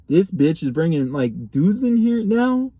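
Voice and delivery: indignant, high-rising question; incredulous outrage. Retold or imitated. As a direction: re-enact outraged disbelief, climbing high on the question. Her jealous reaction, re-enacted.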